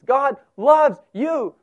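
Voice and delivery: loud, slow, high declaration; assured and emphatic, every word given room; confident. Shown as a demonstration. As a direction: proclaim it with full confidence. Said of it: "you can say it with confidence".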